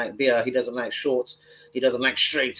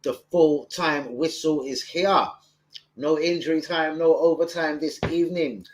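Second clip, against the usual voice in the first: drawn-out announcer call, pitched up; mock full-time announcement; 4 semitones higher, slower than usual. Announces the end of the stream.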